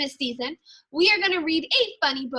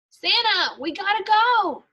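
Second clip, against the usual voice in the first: higher, louder call down the chimney; yelling down the chimney; 6 semitones higher, 3 dB louder than usual. Acted. Elf calling Santa down the chimney to leave, urgent yell. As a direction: hurry Santa along with a yell. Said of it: "when I yell down the chimney".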